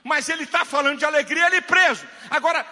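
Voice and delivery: loud, high-pitched pulpit shout; full-force preaching. Real, not acted. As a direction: preach it at the top of your voice.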